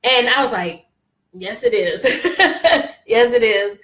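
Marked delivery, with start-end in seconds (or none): 1.3-3.8 laughing reply. Retold or imitated. Laughing as she recounts her reply.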